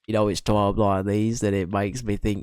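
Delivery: a hint of an Australian accent; lightly voiced Aussie charm. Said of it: "I'm being Hugh Jackman".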